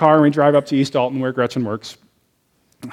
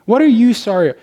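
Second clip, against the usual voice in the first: higher and louder, baffled; surprised; 7 semitones higher, 5 dB louder than usual. Retold or imitated. To his wife who says sorry, a higher, louder baffled question. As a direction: burst out in disbelief at the apology.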